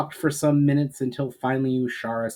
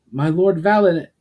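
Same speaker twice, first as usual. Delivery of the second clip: raised voice, cut off mid-name; calling out; 7 semitones higher, 8 dB louder than usual. Acted. Guard calling to startled Valinar, urgent raised call. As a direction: call his name urgently, then break off.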